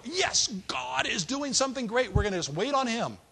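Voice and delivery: high, mock-triumphant cheer; excited relief. Brothers realising God is at work, excited relief.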